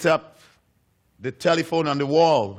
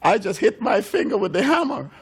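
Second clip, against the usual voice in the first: put-on child's complaint; 8 semitones higher than usual. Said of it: "And Paul would say".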